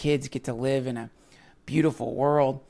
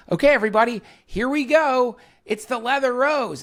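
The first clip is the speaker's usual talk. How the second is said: high, loud announcer intro; show announcer; 11 semitones higher, 7 dB louder than usual. Acted. Host opening the podcast, loud announcer voice. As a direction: boom out the show title like a host.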